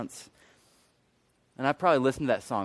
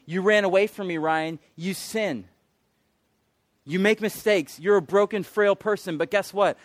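loud, high, insistent preaching; impassioned divine address; 8 semitones higher, 8 dB louder than usual. Retold or imitated. God to a man who ran away, impassioned address. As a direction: list the failings out forcefully. God's speech, preached forcefully.

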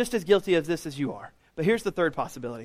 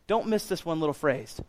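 ordinary talk

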